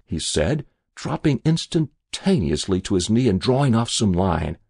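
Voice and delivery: plain reading voice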